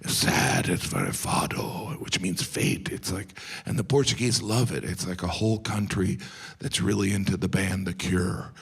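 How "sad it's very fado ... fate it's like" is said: normal talking voice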